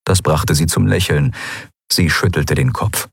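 usual speaking voice

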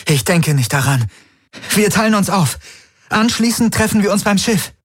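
high, determined retort; urgent insistence. A narrator's reading. Kirai refuses to stay behind, high urgent insistence. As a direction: refuse and take charge, climbing in pitch.

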